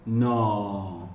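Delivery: मौ and नौ drawn out slowly; slow demo. Shown as a demonstration. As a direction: stretch each syllable, मौ then नौ. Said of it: "तो अब slowly बोलिए".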